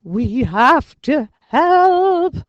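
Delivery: high, loud, slow desperate plea; cried out. A narrator's reading. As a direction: beg to rush in. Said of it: "cried Arthur".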